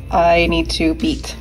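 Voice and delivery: ordinary talk, nothing marked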